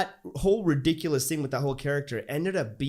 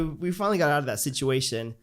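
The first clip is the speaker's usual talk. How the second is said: ordinary talk, nothing marked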